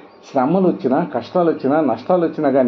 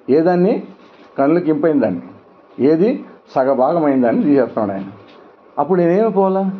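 ordinary talk

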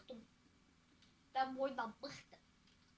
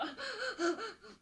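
higher burst of laughter; laughing after a mock threat; 5 semitones higher than usual. Real, not acted. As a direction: laugh in a run of quick ha-ha bursts.

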